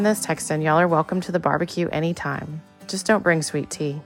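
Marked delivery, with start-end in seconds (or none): none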